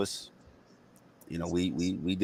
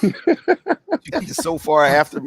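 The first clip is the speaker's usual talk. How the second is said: high laughing exclamation; 10 semitones higher, 7 dB louder, much slower than usual. Real, not acted. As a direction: squeal it out through a laugh. Laughing disbelief at backwards pants.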